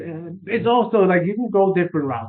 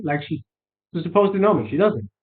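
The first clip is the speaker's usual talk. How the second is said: ordinary talk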